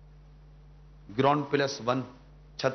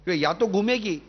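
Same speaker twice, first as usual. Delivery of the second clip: higher, impassioned sermon voice; fervent preaching; 6 semitones higher than usual. Acted. Preacher urging the sisters on TV, impassioned and higher. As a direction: plead with the listening sisters, voice lifted high.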